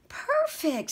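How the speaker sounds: high squeaky mouse voice; a tiny mouse who found the perfect spot; satisfied high squeak; pleased mouse. A narrator's reading. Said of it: "said Mortimer".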